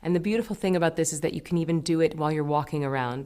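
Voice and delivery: usual speaking voice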